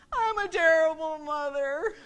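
slow, drawn-out self-pitying moan; mock wail. New parent unsure what to do, mock wailing. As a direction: stretch it into a hammed-up guilty lament.

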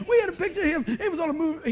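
high laugh through the words; words break into a laugh, near tears. Real, not acted. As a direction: laugh fondly remembering dad. Laughing remembering dad.

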